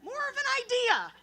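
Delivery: a mother gently deflating Santa; mom's voice. Retold or imitated. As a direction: brush it off like a breezy mom. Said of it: "my mom says to me".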